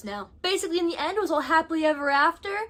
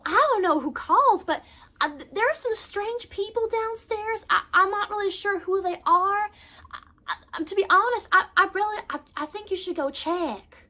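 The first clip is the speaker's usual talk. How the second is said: higher put-on Southern accent, faking innocence; fake Southern accent; 6 semitones higher than usual. Pansy tells the police in a fake Southern accent. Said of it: "develops this fake Southern accent".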